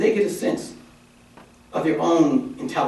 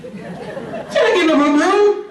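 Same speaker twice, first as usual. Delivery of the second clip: much higher, slow, laughing jab; mocking squeal; slower than usual. Real, not acted.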